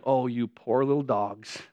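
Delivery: mock pity. David pitying his defeated enemies, soft mock pity. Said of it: "And he's like".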